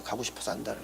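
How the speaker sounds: quiet echo. Shown as a demonstration. Preacher echoing his own line softly. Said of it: "가고 싶어서 안달을 하는 거예요".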